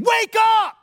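a sudden high, loud yell; the alarm-clock yell Jesus is not giving; shouted wake-up. Shown as a demonstration. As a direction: jolt someone awake with a shout. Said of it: "I don't think that Jesus is saying".

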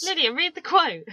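high, insistent call to a co-host; impatient nagging. Real, not acted. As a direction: nag her to get on with it. Repeats the demand to co-host.

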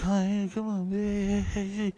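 high, soft pleading calls; muffled pleading. Retold or imitated. Dave calling softly from the back room, high. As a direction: plead through a door. Friend pleading behind door.

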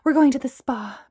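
out of breath; breathless. A narrator's reading. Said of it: "Bree said breathlessly".

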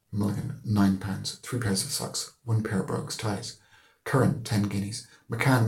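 ordinary delivery

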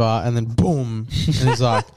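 ordinary talk

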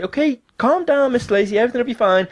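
high-pitched, sing-song soothing; put-on high helpline voice; reassuring call agent. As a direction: soothe a panicking customer, pitched right up. Agent reassures the panicking caller.

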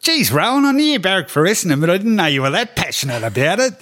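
put-on high-pitched, quick, amused voice of a dry old coach; Lee Matthews impression. Coffee-soaked colleague ribbing the narrator in a high voice. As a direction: squeak it out, unbothered and teasing. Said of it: "he's got a funny sort of high-pitched voice".

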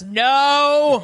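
retold refusal. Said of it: "Killian just says".

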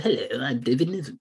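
clipped upper-class RP; crisp officer-class English; David Niven posh voice. Retold or imitated. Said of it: "less clippy sort of".

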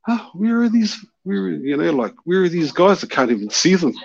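high, puzzled searching voice; lightly voiced confused friend; confused mate.

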